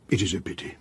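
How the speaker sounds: soft regret. Acted. Detective repeats his regret to the maid, murmured. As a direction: murmur it again to yourself.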